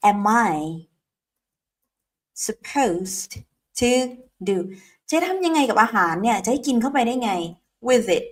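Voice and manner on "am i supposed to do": "What am I supposed to do?" broken into slow separate words; slow drill. Shown as a demonstration. Teacher says it slowly for students to repeat together. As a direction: chop the question into slow single words for repeating. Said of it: "ออกเสียงพร้อมกันนะ".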